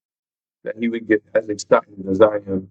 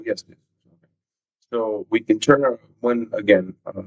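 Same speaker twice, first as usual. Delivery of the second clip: normal talking voice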